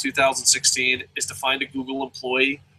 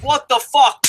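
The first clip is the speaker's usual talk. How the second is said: mock outraged yell; 9 semitones higher, 4 dB louder, slower than usual. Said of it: "and he's like".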